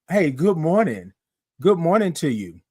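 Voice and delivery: ordinary talk, nothing marked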